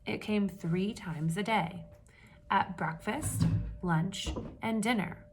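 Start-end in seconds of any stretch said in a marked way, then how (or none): none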